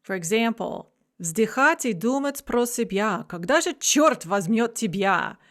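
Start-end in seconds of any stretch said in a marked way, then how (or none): 1.2-5.4 poem recitation. Shown as a demonstration. Teacher reciting an example of masculine rhyme, verse recitation. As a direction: recite the Russian verse with a lilt.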